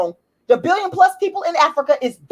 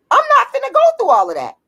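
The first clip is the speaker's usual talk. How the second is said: "go" pitched higher in fed-up disbelief; exasperated higher repeat; 11 semitones higher, faster than usual. Real, not acted. Ranting vlogger repeats it, higher and fed up. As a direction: say it again up high, done with it.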